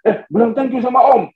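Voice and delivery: high parental prompt; prompting father. Retold or imitated. Father telling his child to thank Uncle, high-pitched prompting. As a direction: call out high, correcting a child. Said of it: "saya bilang".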